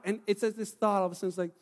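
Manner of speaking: normal talking voice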